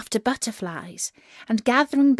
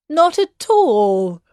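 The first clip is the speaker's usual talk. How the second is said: mock brave; 6 semitones higher, 7 dB louder, slower than usual. Listener claims bravery.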